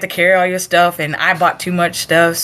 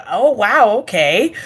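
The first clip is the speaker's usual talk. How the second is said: mock stunned reaction, starting high; retold shock; 4 semitones higher, slower than usual. Recruit just cussed out, retold shock. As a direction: take it in, then settle to a small okay.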